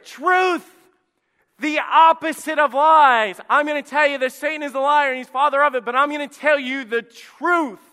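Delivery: high, loud preaching shout; full-force preaching. Acted. Preacher shouting the truth at his church, loud and high. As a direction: drive it home to the congregation.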